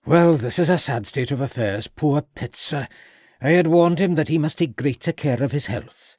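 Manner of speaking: lightly Welsh country doctor; a doctor in a mild Welsh lilt; Welsh accent. A narrator's reading. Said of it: "said Gladwin in a pronounced Welsh accent".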